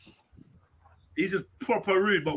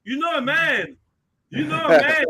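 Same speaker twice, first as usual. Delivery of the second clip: hammed-up drunk beggar voice, higher; mock the slurring beggar; imitating the beggar; 5 semitones higher than usual. Mocking the beggar's catchphrase.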